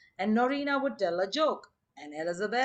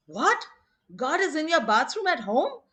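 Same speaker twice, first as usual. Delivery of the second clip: high-pitched mock disbelief; astonished teacher; 6 semitones higher, 3 dB louder than usual. Teacher questions Tommy's answer, acted astonishment. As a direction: echo it as an incredulous question, pitched up.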